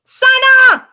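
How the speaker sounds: high, loud furious yell of a name; angry shout. A narrator's reading. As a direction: yell at the little sister who ate the lollipop. Big sister finds lollipop eaten.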